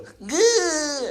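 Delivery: high, loud held squeal; high mock cry of pain. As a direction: imitate a kid's yelp on impact. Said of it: "I heard him go".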